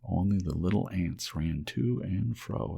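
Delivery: ordinary delivery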